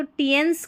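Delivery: "टियंस" with a hard T, ti-yens; misreading TI as T. Shown as a demonstration. Teacher showing a likely misreading, "टियंस" with a hard T. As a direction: say "टियंस" as spelled, T then yens. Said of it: "टी को ट pronounce किया जा रहा है".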